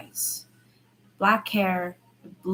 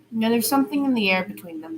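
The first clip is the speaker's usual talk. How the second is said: ordinary delivery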